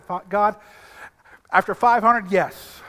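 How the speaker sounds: ordinary talk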